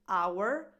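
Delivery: "our" in full, as "ow-er". Shown as a demonstration. Coach naming the word before reduction, full our. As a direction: sound out "our" like "hour". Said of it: "So this word".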